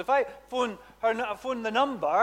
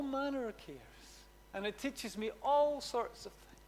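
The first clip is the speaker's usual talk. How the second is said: quiet, humble prayer voice, fading softer; sample thankful prayer; 9 dB quieter than usual. Shown as a demonstration. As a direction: pray it humbly and gently. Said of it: "That's thankful prayer".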